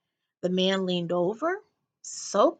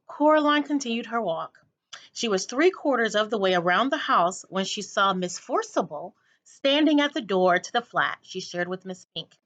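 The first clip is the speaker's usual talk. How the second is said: ordinary delivery, nothing marked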